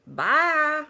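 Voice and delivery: a high, drawn-out Alabama bye; mock Southern bye. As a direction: bye sung up like a Southern girl. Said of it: "She goes".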